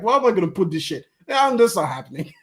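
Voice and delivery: ordinary talk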